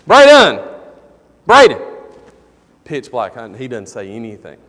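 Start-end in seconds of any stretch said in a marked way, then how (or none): 0.1-0.6 loud, high shout for a son; calling out. Retold or imitated. Father calling into a dark hole for his son, loud shout. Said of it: "So I stick my head down in there". 1.5-1.8 short, loud repeated shout; calling out. Retold or imitated. Father calling his son down a dark hole, short shout. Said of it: "So I stick my head down in there".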